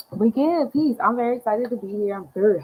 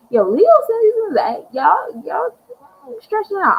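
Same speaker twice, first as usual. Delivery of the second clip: hyped announcement; 12 semitones higher, 6 dB louder than usual. Real, not acted. Hyped announcing Leo season.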